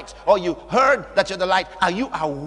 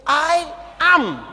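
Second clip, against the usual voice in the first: drawn out, loud and solemn; majestic, slow pulpit declaration; slow proclamation; 5 dB louder, much slower than usual. Acted. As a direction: stretch every word like a decree. Jesus' words proclaimed slowly.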